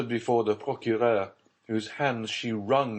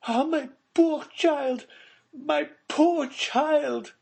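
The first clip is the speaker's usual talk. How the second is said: slow, high lament, louder; anguished cry; 6 dB louder, slower than usual. Acted. Mother mourning her lost child before Villefort, slow high anguished cry. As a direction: draw out the grief for her child. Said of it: "cried the Baroness".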